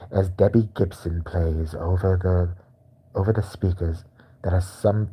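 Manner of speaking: a mock relaxing ASMR voiceover. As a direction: guide the listener gently through it. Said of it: "walk somebody through an abortion".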